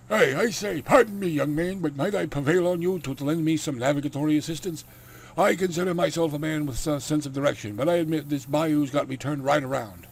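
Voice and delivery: high, loud gentleman's put-on accent; loud, courtly country Dracula accent. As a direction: hail a stranger in a big accented Dracula voice. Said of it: "the accent I gave him".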